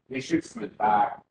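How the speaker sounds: ordinary talk, nothing marked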